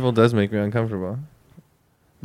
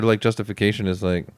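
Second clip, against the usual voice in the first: normal talking voice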